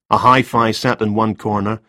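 ordinary delivery

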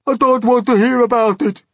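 a high, loud panicked shriek. Acted. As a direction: shriek in panic like a terrified worm. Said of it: "shrieked the poor blind earthworm".

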